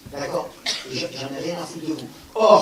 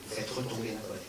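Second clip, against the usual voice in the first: much softer, lower, settling take of "retomber la colère"; calming down; 5 semitones lower, 10 dB quieter than usual. Shown as a demonstration. Speaker shows the audience anger settling, much softer and lower. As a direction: let the words sink softly as the anger drops.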